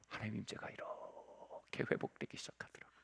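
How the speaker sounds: hushed whispered awe; whispering to the congregation. Real, not acted. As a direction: breathe it as a whisper. Whispered climax of sermon.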